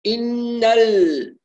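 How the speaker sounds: ال- with the lam freed of ghunna, slow; clean lam. Shown as a demonstration. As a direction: clear lam after the nun. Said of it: "عند اللام أصفي صوتها من الغنة".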